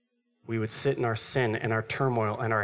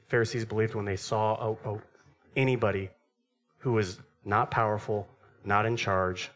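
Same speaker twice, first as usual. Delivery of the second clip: ordinary talk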